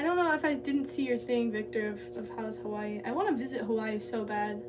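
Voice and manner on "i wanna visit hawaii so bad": normal talking voice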